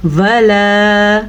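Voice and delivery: वला drawn out slowly; slow clear वला; slow reading. Shown as a demonstration. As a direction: sound out वला carefully. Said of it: "लाम ज़बर अलिफ ला".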